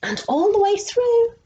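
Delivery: excited storytelling. A narrator's reading. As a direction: thrill the little listeners. Excited story moment for children.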